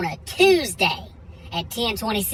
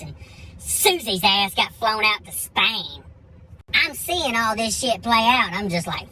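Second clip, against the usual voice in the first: usual speaking voice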